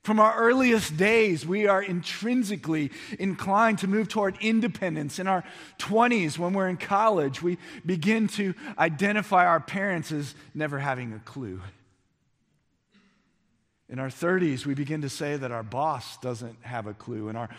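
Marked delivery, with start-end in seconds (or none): 0.0-11.6 high, loud, driving preacher voice; full-force preaching. Acted. Pastor rousing his congregation, loud and high. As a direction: preach it out to the whole room.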